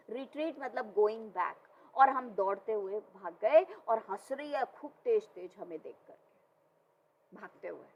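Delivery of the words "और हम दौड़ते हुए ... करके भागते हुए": ordinary talk, nothing marked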